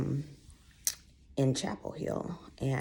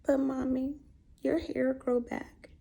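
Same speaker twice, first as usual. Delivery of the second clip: put-on tearful little girl; 11 semitones higher than usual. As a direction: play a little girl about to cry. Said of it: "through her little almost tears".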